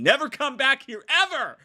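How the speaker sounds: hammed-up high yell; mock banishment. Reviewer telling viewers to leave, mock yell. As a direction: send them away in a mock outburst.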